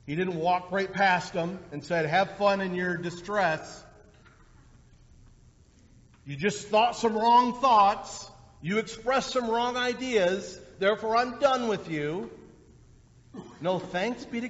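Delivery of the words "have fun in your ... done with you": mock dismissive. Mock uncaring Jesus dismissing disciples.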